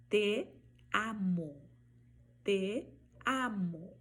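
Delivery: Te amo slowly, E as E, open A; Spanish te amo. Shown as a demonstration. Teacher modeling te amo for Portuguese speakers. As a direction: stretch Te amo with clean vowels. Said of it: "eu vou pronunciar diferente".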